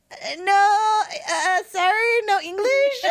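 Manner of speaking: high, hesitant, mock-apologetic; playing dumb. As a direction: pretend you speak no English, giggling. Acting out dodging Spanish speakers.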